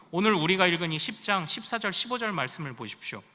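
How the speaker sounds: ordinary talk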